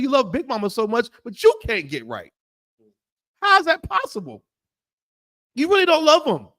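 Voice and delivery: high, rising sermon rebuke; preacher scolding the congregation with rising fire; fiery preaching. Acted. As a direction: hammer the sinners from the pulpit. Preaching at full force to congregation.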